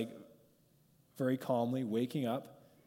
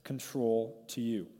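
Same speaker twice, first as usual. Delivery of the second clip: slow surrender; slower than usual. Shown as a demonstration. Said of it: "We need to stop and say".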